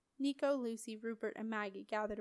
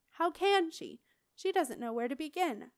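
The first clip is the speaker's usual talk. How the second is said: higher, louder exasperation; exasperated; 6 semitones higher, 5 dB louder than usual. Acted. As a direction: throw up your hands in disbelief. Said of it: "Simon sounded exasperated".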